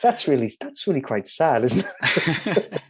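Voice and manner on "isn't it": laughing. Real, not acted. Laughing after admitting sadness.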